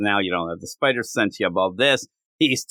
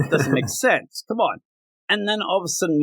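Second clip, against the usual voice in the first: usual speaking voice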